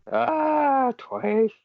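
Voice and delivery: very high, loud cry of sympathy; pitying wail. Real, not acted. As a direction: wail in dismay for a beloved character. Pitying outburst over the character.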